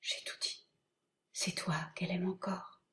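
quick whisper in the ear. Acted.

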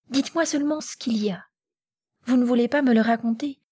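high, quick, urgent pleading; insistance pressante. A narrator's reading. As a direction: press for the secret, fast and high. Natacha presse sa tante de parler. (supplique pressante, aiguë et rapide)

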